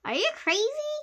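very high-pitched disbelieving question; incredulous. A narrator's reading. Friend hears of the fight plan, incredulous. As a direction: voice leaps up in disbelief.

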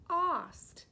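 ost said loudly with an open "ah" vowel, like "ahst"; sounding out ost. Shown as a demonstration. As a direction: punch ost for first graders. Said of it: "like lost and cost".

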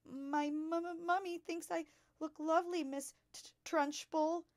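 put-on high, stammering child voice; frightened stutter. A narrator's reading. Terrified pupil defends her looks to the headmistress, stuttering. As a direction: stammer it, shaking with fear. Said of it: "Amanda stuttered".

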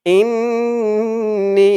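ইন্নী with the nn held and the ni drawn long; long ghunnah. Shown as a demonstration. Teacher showing the held nn and long ni. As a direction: stretch ইন্নী very slowly. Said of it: "ইন বলে গুন্নাহ করতে হবে".

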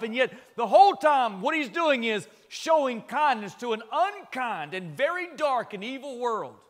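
loud, high, impassioned preaching; fervent climax of a sermon; preaching at full force. Acted. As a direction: thunder it out from the pulpit.

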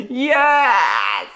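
long, shouted cheer; elated yes. Real, not acted. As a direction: cheer it out and hold the word. Elated about pumpkin icing scent.

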